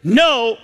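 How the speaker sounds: NO high and forceful after a pause; emphatic no. Acted. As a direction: set NO apart and punch it. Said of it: "give some emphatic".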